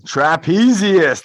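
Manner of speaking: loud and slow, every syllable of Trapezius drawn out; loud, slow model. Shown as a demonstration. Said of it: "Say it even louder".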